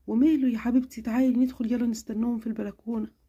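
mother answering her sick daughter, tenderly; caring mother. Acted. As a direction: soothe your little girl. Mother agrees.

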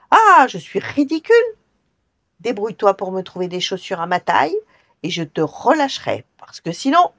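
high, loud angry yelling; furious. Acted. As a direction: roar threats at the goblin.

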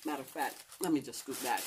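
ordinary talk, nothing marked

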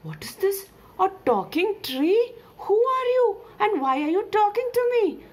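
high, startled character voice; loud, incredulous questions building from the first; astonished questioning. Acted. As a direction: demand answers in high-pitched disbelief. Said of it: "asked Miku".

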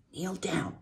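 soft, urgent whisper; quiet urgent advice. A narrator's reading. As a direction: hush it to the pig beside you. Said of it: "whispered the old sheep".

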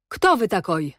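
a touch of contemptuous severity; stern scornful mockery. A narrator's reading. Cossack officer questions captured rider, stern and mocking. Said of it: "obok srogości czuć było wzgardliwą drwinę".

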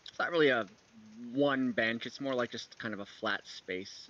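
ordinary delivery

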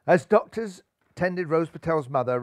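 ordinary delivery, nothing marked

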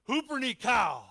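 Hupernikao shouted out high and loud; loud call-and-response shout. Shown as a demonstration. Preacher gets the congregation to shout Hupernikao, loud. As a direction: belt the word Hupernikao. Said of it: "turn to your neighbor and say".

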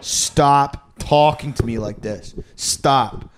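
very slow, drawn-out plea; weary plea. Retold or imitated. Patient begging doctor to stop, very slow. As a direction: drag it out, begging him to stop. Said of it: "And I'm like".

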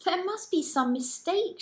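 put-on high young girl's voice, worried and unsure; frightened young Mary. A narrator's reading. Mary protesting to the angel, high worried girl's voice. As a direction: voice Mary as a scared girl protesting. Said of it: "she said".

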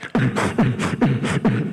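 low thumping mouth-made beat; vocal dance-track thump; imitated club music.

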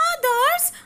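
high rising little-fish question; Sterling hearing of his friends; surprised question. Acted. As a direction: perk up at the news. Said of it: "asked Sterling".

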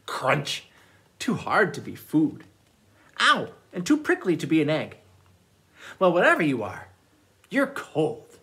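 put-on lively read-aloud for children; storytime reading. A narrator's reading. Librarian reads picture books aloud.

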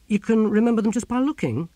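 high-pitched doubting question; incredulous. Acted. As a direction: question it in disbelief. Ern doubts the memory feat.

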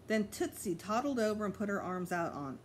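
ordinary delivery

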